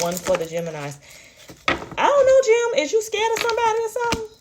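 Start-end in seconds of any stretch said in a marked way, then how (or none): 1.7-4.3 very high squeaky cartoon voice. Squeaky voice addressing Gemini.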